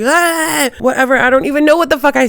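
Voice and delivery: high-pitched, awkward "whatever" tossed out the window; flustered brush-off. Retold or imitated. Flustered reply to flirting bouncer.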